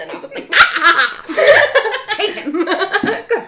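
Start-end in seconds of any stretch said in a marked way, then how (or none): 0.5-1.2 loud, high yelp of disgust; mock horrified scream. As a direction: scream it, half laughing. Acts own horrified reaction.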